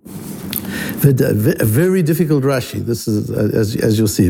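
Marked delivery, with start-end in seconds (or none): none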